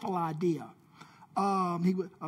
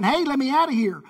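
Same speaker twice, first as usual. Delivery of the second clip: louder, higher, quick plea; trapped man calling out; 6 semitones higher, 7 dB louder, faster than usual. Pretend. Buried man knocking on the rock to get out, playful calling out. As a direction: call out from behind the rock. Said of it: "knocking on that rock going".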